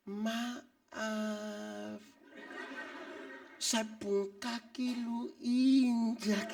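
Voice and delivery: mock a timid over-gentle excuse me; overly polite apology. Shown as a demonstration. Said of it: "bukan berarti omong pelan-pelan".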